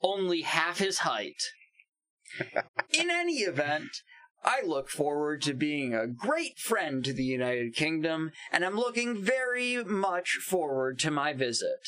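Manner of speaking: mock-presidential read-out with swooping pitch; dramatic tweet reading. Podcaster reading favorite Trump tweets aloud, theatrical declaiming.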